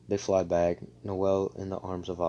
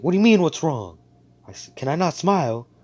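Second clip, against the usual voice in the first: higher and louder, offended retort; indignant snap; 8 semitones higher, 8 dB louder than usual. Retold or imitated. Noelle snapping at Asta, higher indignant retort. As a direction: throw his question back at him.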